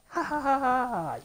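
loud triumphant ha-ha-ha; gloating laugh. Real, not acted. Player beating the boss, gloating laugh. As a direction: crow with laughter at the loser.

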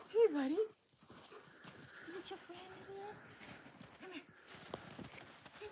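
curious dog talk. Real, not acted.

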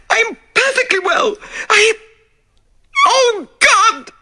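high, sobbing, rising to a cry; hysterical protest. Acted. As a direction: break down into panic. Widow protesting, then terror.

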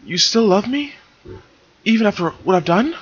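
high, small mare's voice, pleading; Silver's voice. Acted. Silver asking Moltar if he still loves her, high and pleading. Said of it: "Silver asked".

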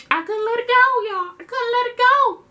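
emphatic. Real, not acted. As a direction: sing it out in disbelief. Emphatic repeated confession.

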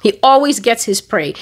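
usual speaking voice

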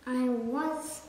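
plain reading voice

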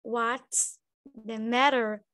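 What's the matter bem devagar; slow, drawn out. Shown as a demonstration. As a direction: matter esticado, desenhando o som. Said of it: "desenha o som". (desenhando o som devagar)

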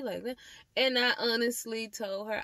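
usual speaking voice

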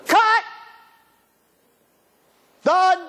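shouted director's call; director calling cut. Acted. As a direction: bark it across the film set. Director calling end of scene.

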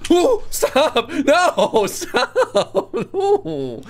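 high squealing mock fright; mock scared squeal. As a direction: shriek no through laughter.

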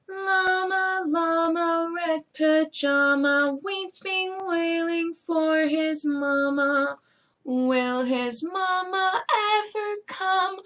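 lilting storybook reading; reading to children. Acted. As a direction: read it like bedtime story time. Reads the story aloud to children.

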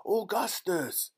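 high, slow, drawn-out call; stretched, agitated storybook shout; frantic cry. A narrator's reading. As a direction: cry the name out in alarm. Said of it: "cried Mister Wonka".

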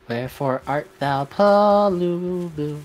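high, slow dramatic call; hammed-up Shakespeare plea; theatrical Romeo.

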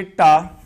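टा said loudly with a crisp stop; clear unaspirated ta. Shown as a demonstration. Teacher reading out टा to the class. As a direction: punch out टा. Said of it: "पढ़ के बताइए कैसे पढ़ोगे".

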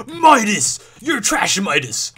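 loud, high mock yell easing off by the end; imitating a berating coach; imitated coach yelling. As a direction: yell at one kid like a fed-up coach. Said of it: "most of the time he was yelling".

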